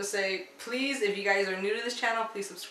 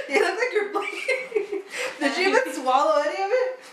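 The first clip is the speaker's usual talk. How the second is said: breaking into laughter; words squeezed out between laughs; laughing; 8 semitones higher than usual. Real, not acted. Laughing at friend eating candy.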